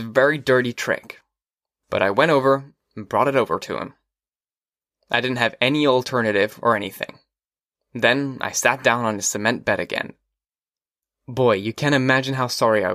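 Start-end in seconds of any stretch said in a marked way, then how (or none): none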